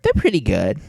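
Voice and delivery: high, sing-song forced politeness; fake polite praise. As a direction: pretend to like it. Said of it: "I was like".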